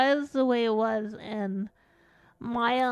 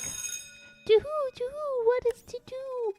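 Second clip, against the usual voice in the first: child imitating owl; 13 semitones higher than usual. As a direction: hoot it high like a kid playing owl. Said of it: "going around my day saying to who".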